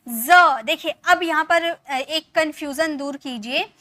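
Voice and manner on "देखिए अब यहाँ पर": usual speaking voice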